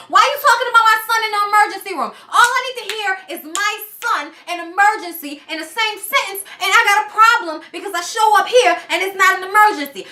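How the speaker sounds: high-pitched, exasperated demands; mock angry Yandy. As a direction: imitate her flipping out at him. Retelling Yandy confronting Mendeecees.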